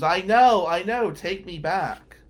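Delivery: mock scream, loud and pleading; retold scream. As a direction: imitate her screaming back at him. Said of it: "And she screams".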